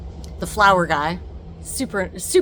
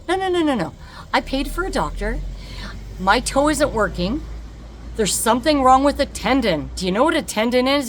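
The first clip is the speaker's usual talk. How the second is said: higher, louder, pushing back hard on the doctor; exasperated insistence; 7 semitones higher, 5 dB louder than usual. Retold or imitated. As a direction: insist firmly, running out of patience.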